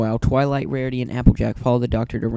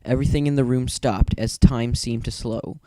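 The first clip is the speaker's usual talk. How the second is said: usual speaking voice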